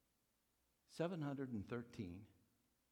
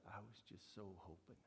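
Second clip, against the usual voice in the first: much softer, whispered with fading hope; broken-hearted whispered reply; heartbroken whisper; 10 dB quieter than usual. Retold or imitated. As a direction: whisper it like hope just died. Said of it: "Long silence".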